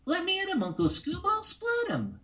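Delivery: eager little pup itching to fight; Scrappy-Doo. Retold or imitated. Guest doing Scrappy-Doo for the hosts, high and feisty. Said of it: "That was Scrappy Doo".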